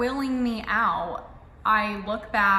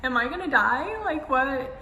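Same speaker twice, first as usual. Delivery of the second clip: laughing nervously; 4 semitones higher than usual. Real, not acted. Laughing while recalling fear.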